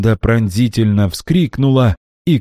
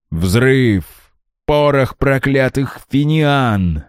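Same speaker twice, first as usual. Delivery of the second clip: slow, higher, furious; growling in rage; 7 semitones higher, slower than usual. A narrator's reading. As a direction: snarl out the curse. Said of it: "рычал Милон". (яростно, с рычанием)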